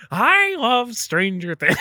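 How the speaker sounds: high, slow, creepy clown voice; drawn-out creepy Joker impression; Heath Ledger Joker. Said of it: "That was the Heath Ledger Joker".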